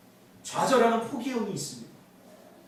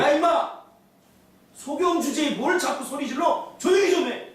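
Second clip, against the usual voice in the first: raised, higher-pitched mock rebuke; scolding; 5 semitones higher, 3 dB louder than usual. Crowd silencing a blind man calling out, scolding. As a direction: act out a crowd telling someone off loudly. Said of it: "그러면서 막 꾸짖습니다".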